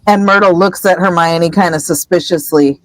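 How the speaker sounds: ordinary delivery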